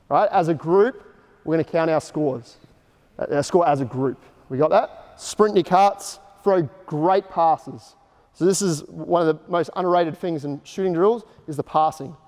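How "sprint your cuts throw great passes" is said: slow, each word set apart for the players; slow teaching points. Shown as a demonstration. Coach to players before the drill, slow and deliberate. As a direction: spell out the key points slowly. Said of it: "our teaching points for this is sprint your cuts".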